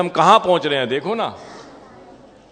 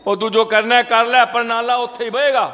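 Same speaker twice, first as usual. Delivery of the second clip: Punjabi goon; 6 semitones higher than usual. Retold or imitated. The neighbour in Punjabi, refusing to stop the drain. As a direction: toss it off like a stubborn Punjabi bully. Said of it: "Punjabi था वो".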